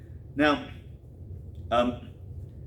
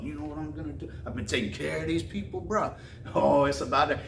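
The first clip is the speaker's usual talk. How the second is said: David pumping himself up; 3 dB quieter than usual. Retold or imitated. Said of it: "David was pumping himself up".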